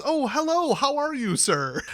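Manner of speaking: high, chirpy, overly polite neighbor voice; suddenly sweet and polite to the police officer; Ned Flanders voice. Retold or imitated. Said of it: "all of a sudden I'm Ned Flanders".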